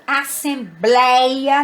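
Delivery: "assembleia" slow, with an open éi; open diphthong. Shown as a demonstration. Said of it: "eles falam em ditongos abertos".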